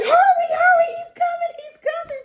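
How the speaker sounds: very high squealing urgency; acted panic. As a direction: squeal the warning, fading as it repeats. Fatima urging as monster approaches.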